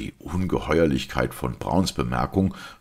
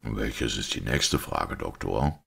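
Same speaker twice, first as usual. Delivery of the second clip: slow, lower-pitched priest; composed priest; 5 semitones lower, 3 dB quieter than usual. Acted. Priest inviting the doctor's next question, composed. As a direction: ask slowly, as a composed priest.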